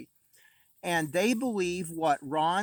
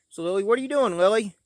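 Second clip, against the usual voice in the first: calling out; 4 semitones higher, 6 dB louder, faster than usual. Real, not acted. Calling to daughter outside.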